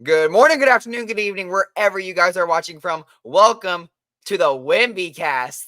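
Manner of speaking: loud upbeat host opener; show intro. Acted. Host welcoming viewers to the podcast, upbeat announcer.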